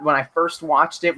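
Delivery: ordinary talk, nothing marked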